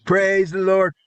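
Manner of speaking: normal talking voice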